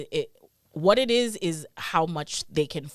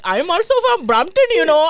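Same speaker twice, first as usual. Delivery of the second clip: a bad Indian accent, much higher and louder; 12 semitones higher, 11 dB louder than usual. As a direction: ham up a badly faked Indian accent. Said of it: "he just did an Indian accent. A bad one".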